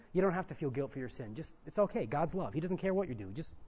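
soft, offhand permissive voice; easygoing liberalism. Acted. Preacher voicing liberalism excusing sin, soft and dismissive. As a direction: wave the guilt away quietly and casually. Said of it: "Liberalism says".

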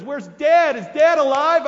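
desperate to know his father lives; eager urgency. Retold or imitated. Joseph to his brothers, urgently asking about their father.

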